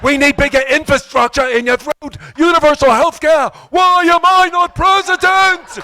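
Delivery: high, loud shouting stump-speech voice; Bernie Sanders impression. Brain talking like a senator at a rally, shouting high. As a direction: rant like an old senator at a rally. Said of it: "my brain sounds like Bernie Sanders".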